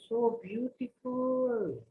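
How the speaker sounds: high, slow, breathy-free marvel at beauty; a cobbler's high, drawn-out gasp of delight; delighted awe. Acted. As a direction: savour each word in wonder. Cobbler delighted by Krishna news.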